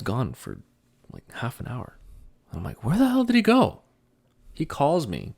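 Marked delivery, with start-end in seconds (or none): 2.8-3.8 high, loud exasperated cry; exasperated outburst. Retold or imitated. Son waiting on his dad, a high exasperated yell. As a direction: throw the question up in disbelief.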